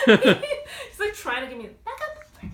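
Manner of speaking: laughing high and loud. Real, not acted. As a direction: giggle through the words. Laughing at dog.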